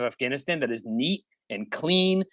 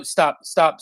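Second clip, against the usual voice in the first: insistent stop; 3 semitones higher, 5 dB louder than usual. Real, not acted. Repeated demands to stop.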